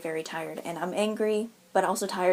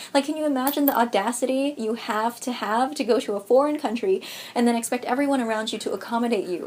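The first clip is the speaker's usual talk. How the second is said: normal talking voice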